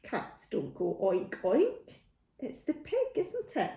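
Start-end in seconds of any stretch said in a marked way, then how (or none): 2.4-3.7 playful questioning. Acted. Teasing question to children.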